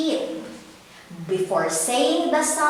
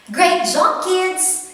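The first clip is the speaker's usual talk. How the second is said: cheerful praise; 7 semitones higher, 5 dB louder than usual. Real, not acted. Teacher praising the class.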